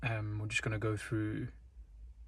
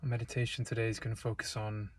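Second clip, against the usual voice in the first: calm, unhurried meditation voice with long pauses; guided meditation; 4 dB quieter than usual. Real, not acted. Guide leading listeners into breath and stillness, calm meditation delivery. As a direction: guide them gently, leaving space between phrases.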